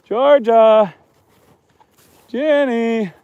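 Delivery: calling the donkeys by name in the dark. Real, not acted. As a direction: call them across the field.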